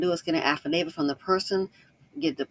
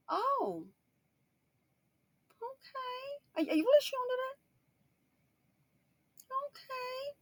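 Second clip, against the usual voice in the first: tiny, very high, soft voice; mock-crushed, high and quiet; acted hurt disbelief; 7 dB quieter than usual. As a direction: shrink into a small, wounded squeak. Acts out hurt, let-down reply.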